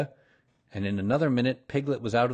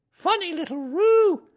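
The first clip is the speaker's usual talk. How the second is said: very high, loud mother kangaroo; high-pitched motherly remark; Kanga's voice; 8 dB louder than usual. A narrator's reading. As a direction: tease the child in a high character voice. Kanga amused by supposed child.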